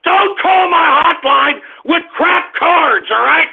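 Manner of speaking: slow, high, angry scolding; a furious hotline host; angry rant. Acted. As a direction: scold the caller hard and slow. Ranting at callers with stolen cards.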